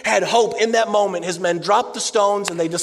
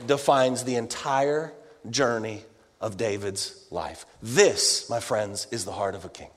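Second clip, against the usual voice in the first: hushed emphasis; 10 semitones lower, 7 dB quieter than usual. Real, not acted. Climactic sermon point.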